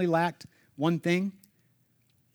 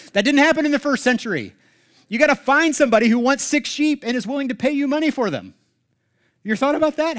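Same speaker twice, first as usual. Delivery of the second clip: higher and louder, driving it home; impassioned preaching; 8 semitones higher, 6 dB louder than usual. Real, not acted. Pastor urging the congregation about selling everything, preaching loudly. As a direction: preach it out with force.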